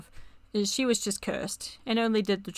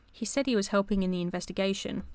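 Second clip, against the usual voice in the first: usual speaking voice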